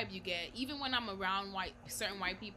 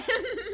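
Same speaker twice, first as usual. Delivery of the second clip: high squealing laugh; 13 semitones higher, 8 dB louder than usual. Real, not acted.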